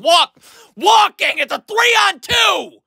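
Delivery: high, loud, slow yell; furious shout. Real, not acted. Coach yelling at Kevin Love for walking, slow furious shout. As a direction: bellow it stretched out in outrage.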